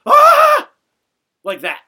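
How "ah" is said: high, loud piercing "Ah!"; a loud reenacted scream of fright; imitated scream. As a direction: scream like you just found a body. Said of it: "literally screams".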